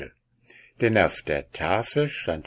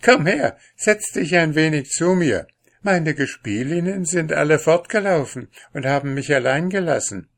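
put-on high, louder girlish invitation; lively princess, inviting; 7 semitones higher, 6 dB louder than usual. A narrator's reading. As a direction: voice a princess eagerly beckoning company. (lebhafte Prinzessin, hoch und einladend)

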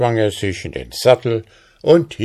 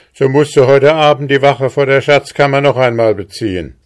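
loud, fast order with raised pitch; threatening; 6 semitones higher, 6 dB louder, faster than usual. A narrator's reading. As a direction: issue a brisk, loud threat as the officer. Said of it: "drohte der Rittmeister". (drohend, laut und zügig)

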